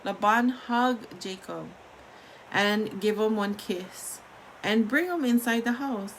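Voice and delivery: ordinary delivery, nothing marked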